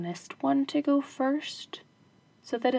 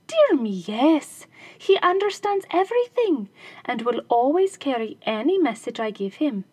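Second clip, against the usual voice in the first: wife's voice; 3 semitones higher, 5 dB louder than usual. Acted. Wife convincing robbers the dog is clever, innocent. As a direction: assure them sweetly.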